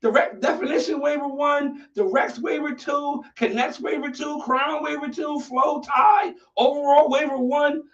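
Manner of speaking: mock the vote in disbelief; incredulous rant. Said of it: "I said".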